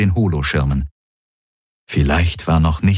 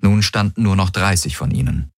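plain reading voice

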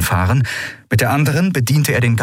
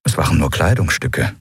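ordinary talk, nothing marked

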